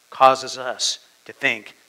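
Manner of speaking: ordinary delivery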